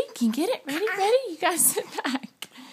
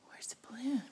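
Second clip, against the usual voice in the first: whisper; 14 dB quieter, faster than usual. Real, not acted. Parent whispering the question to her toddler. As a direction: hush it like a secret game.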